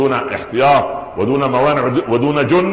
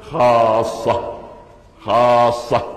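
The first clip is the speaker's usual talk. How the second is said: خاصة said very slowly, first vowel held long; slow, solemn stretch on خاصة; drawn-out emphasis; much slower than usual. Shown as a demonstration. Verse word repeated for emphasis.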